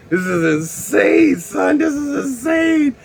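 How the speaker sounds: slow start climbing to high squeal; building amazement. Acted. Tripping man swimming on the floor, rising squeal of amazement.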